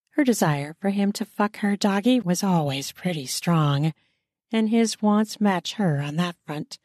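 ordinary delivery, nothing marked

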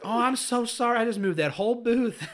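high, loud flustered mom; mortified apology. Retold or imitated. Mom apologizing to the diner she shoved, high flustered gush.

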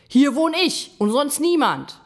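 loud, high angry yell; territorial shout. A narrator's reading. Gram tells the stranger who lives here, yelling. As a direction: shout to claim your ground. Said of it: "schrie der Gram das Tier an".

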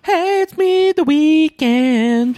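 loud, very high falsetto greeting. Retold or imitated. Singer introducing himself to someone, falsetto impression. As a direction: talk entirely in singing falsetto. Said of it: "he has to talk in that voice".

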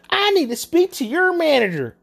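high, loud entitled demand; mock Karen demand. Imitating the customer demanding a manager, high and loud. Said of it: "The next phrase she says".

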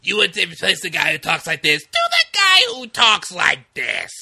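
squawking Gottfried voice through laughs; Gilbert Gottfried impression. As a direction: screech it like Gottfried. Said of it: "Gilbert Gottfried impression".

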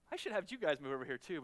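ordinary talk, nothing marked

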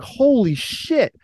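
high, drawn-out holy shit; a slow, high-pitched gasp of amazement; stunned disbelief. Retold or imitated. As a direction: let it hang, slow and stunned. Retelling his shock at Bobby.

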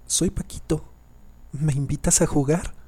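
put-on high child voice, rising question at the end; little boy's voice. A narrator's reading. Ghost boy at the door answers grandmother, childlike voice. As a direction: play a small boy asking to join in. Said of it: "la voz de un niño respondió".

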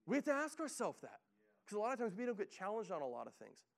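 fired-up preacher pushing the church to act; preaching at full force. Acted.

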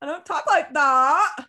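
loud, slow, high protest; indignant protest. Retold or imitated. Teen daughter protesting to her mom, loud and slow. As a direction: insist you don't sound like that. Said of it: "And I was like".

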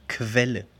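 "Quelle" with Qu said as "kv"; German Qu. Shown as a demonstration. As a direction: say "Quelle" like "KVEL-le". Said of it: "se pronuncia como K W". («Quelle» pronunciado «kvéle», a la alemana)

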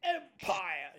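put-on slow, high, thin old voice; creaky ancient emperor voice; very old man. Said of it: "me gusta hacer la voz del abuelo".

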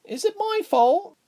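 put-on murmuring little girl. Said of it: "a voice murmured".

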